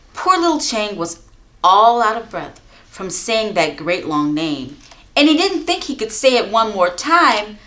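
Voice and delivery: usual speaking voice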